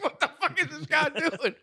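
high, startled voice of a baffled soldier; bewildered torturer. Retold or imitated. Friend voicing a confused interrogator, high and baffled. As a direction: pitch it up high, baffled at the prisoner. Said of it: "So like".

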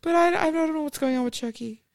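put-on pleading kid. Kid pleading, acted quote.

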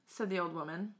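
usual speaking voice

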